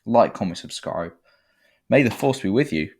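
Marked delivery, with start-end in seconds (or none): 1.9-2.9 louder, higher cheery catchphrase; hearty sci-fi farewell; upbeat sign-off. Real, not acted. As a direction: send them off with a flourish.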